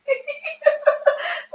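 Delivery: squealing high giggle; real giddy high laugh; high delighted laugh. Real, not acted. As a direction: burst into a squeaky happy laugh. Overjoyed at follower.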